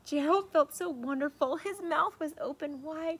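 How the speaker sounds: lively storybook read-aloud; reading to children. A narrator's reading. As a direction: read it like bedtime story for kids. Reads picture book aloud.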